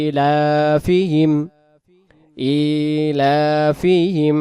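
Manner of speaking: ইলাফিহিম with ই and লা held one alif, slow; one alif stretch. Shown as a demonstration. Teacher demonstrating ইলাফিহিম with the stretch. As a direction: hold ইলাফিহিম's first syllables one beat. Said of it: "প্রথম দুই অক্ষরকে এক আলিফ করে টান দেব".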